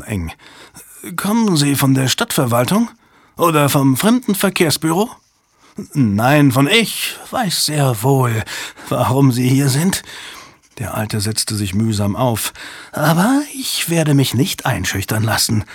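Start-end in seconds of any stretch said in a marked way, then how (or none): 1.1-10.0 put-on higher, prickly old journalist; lightly voiced wary old man; suspicious old man. 12.9-15.6 put-on higher, proud old reporter on his dignity; lightly voiced indignant old journalist; indignant old man. Old journalist defends his honour.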